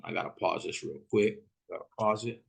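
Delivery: ordinary talk, nothing marked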